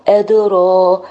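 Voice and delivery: ordinary talk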